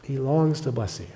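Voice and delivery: soft, hushed repeat of the line. Acted. Pastor repeating God's longing to the congregation, hushed. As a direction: let the line sink in gently.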